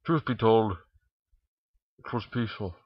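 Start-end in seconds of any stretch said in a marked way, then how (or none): none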